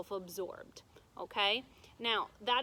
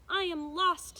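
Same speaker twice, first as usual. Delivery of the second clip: higher, louder plaintive princess; lost princess; 8 semitones higher, 6 dB louder than usual. A narrator's reading. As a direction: sound helpless and lost. Princess speaks to witch.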